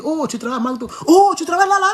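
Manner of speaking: high-pitched retold "oh!" of discovery; surprised recognition. Policeman spotting driver's McDo badge, surprised recognition. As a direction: imitate someone suddenly recognising a workplace, voice leaping up.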